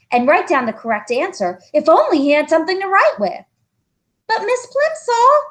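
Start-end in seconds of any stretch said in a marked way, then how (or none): 4.3-5.4 put-on high, pleading little boy voice; young boy pleading. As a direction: beg like a worried schoolboy. Child John's voice, high.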